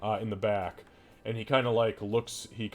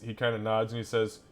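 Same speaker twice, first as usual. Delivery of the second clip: ordinary talk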